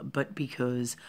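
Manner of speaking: ordinary talk, nothing marked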